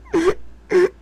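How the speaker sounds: squeaky burst of giggling; high giggle. Real, not acted. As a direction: let the giggle escape.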